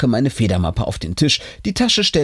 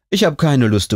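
ordinary delivery, nothing marked

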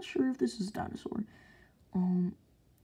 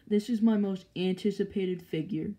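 ordinary talk

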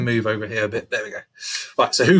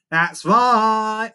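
high, loud, stretched reply; cheeky little brother; 10 semitones higher, 5 dB louder than usual. A narrator's reading. Little brother caught out, cheeky and defiant. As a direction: own up boldly in a raised voice.

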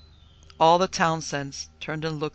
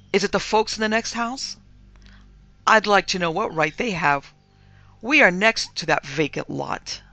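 louder, affronted protest; rousing indignation; 4 semitones higher, 7 dB louder than usual. A narrator's reading. Mistress claiming the vacant lot, indignant protest. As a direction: demand to know, full of indignation. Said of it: "rousing to indignation".

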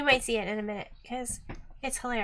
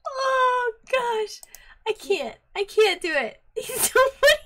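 high, loud words through laughter; real helpless laughing; 9 semitones higher, 6 dB louder than usual. Real, not acted. Learner failing to copy the clip speaker, helpless with laughter. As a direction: give up helplessly, giggling as you speak. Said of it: "I'm laughing so hard".

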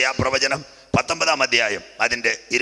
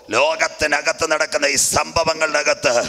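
sustained high-pitched sermon voice; fervent preaching; 5 semitones higher than usual. Real, not acted. Preacher sending believers to the book of Revelation, fervent preaching. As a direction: drive the warning home to the congregation.

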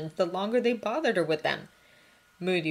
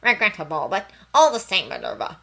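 louder, dismissive minister; arguing minister; 9 dB louder than usual. A narrator's reading. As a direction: brush her off loudly. Fudge arguing in corridor.

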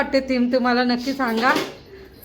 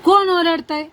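raised teasing question; playful mock scolding; 5 semitones higher, 7 dB louder than usual. Real, not acted. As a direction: pretend to tell off a little shrieker. Mock scolding the shrieking baby.

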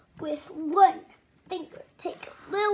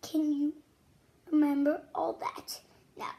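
ordinary talk, nothing marked

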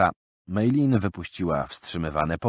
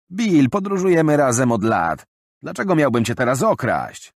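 higher, louder wounded protest; hurt travel companion; 7 semitones higher, 7 dB louder than usual. Acted. Friend protesting to Bill, hurt and loud. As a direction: ask why he'd ever steal.

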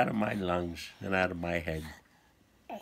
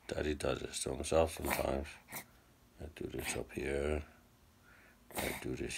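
soft unvoiced whisper; dad whispering to his sick son; 3 semitones lower, 5 dB quieter than usual. Real, not acted. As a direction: hush it like a secret.

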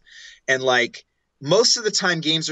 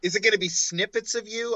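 ordinary talk, nothing marked

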